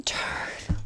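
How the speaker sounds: breathy whisper of the name; hushed aside, barely voiced. Real, not acted. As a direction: let the silly name slip out in a whisper. Reading out the pet's name.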